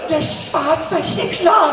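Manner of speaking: high, slow, loud horrified cry; petrified. Retold or imitated. Counter woman staring at the customer, petrified. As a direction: imitate aghast disbelief, drawn out. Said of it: "petrified, and she was like".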